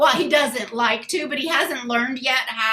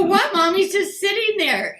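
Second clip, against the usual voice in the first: put-on teen daughter; 7 semitones higher than usual. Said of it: "I go".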